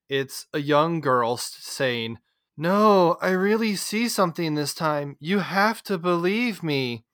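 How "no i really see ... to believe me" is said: bad line read. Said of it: "And the line read was just terrible".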